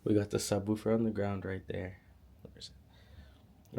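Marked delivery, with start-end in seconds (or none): 2.4-3.8 whispered to self; breathy whispered aside. Real, not acted. Searching for subwoofer aside.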